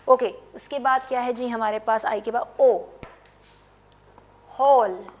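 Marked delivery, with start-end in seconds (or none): none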